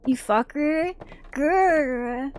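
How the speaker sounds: playful little growl at the enemy; mock growl. Real, not acted. At the monster, a playful growl. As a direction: snarl a cartoon grr.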